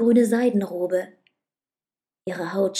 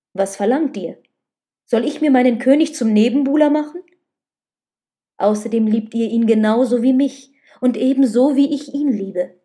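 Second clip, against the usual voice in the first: agitated reproach; 2 semitones higher, 7 dB louder than usual. A narrator's reading. Man reproaches his lover over sharing his king, agitated. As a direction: argue back with heated insistence.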